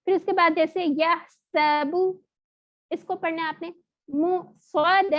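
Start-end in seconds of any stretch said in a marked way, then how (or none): 4.1-5.1 سواد drawn out with a thick, heavy s; heavy saad. Shown as a demonstration. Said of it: "یہ موٹا والا تھا".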